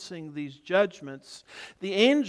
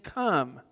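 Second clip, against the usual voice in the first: "Come" drawn out with a falling tune; emphatic come; 3 semitones lower than usual. A narrator's reading. Preacher showing the repeated word in the verses, emphatic. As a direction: let "Come" stand alone as the quoted command. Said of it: "put a special emphasis on the word come".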